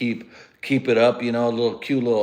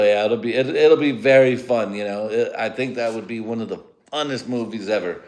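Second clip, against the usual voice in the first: usual speaking voice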